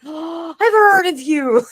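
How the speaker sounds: high, loud thrilled recognition; starstruck. Retold or imitated. Teller recognising the YouTuber, starstruck. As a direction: gasp it like meeting a celebrity. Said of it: "He went".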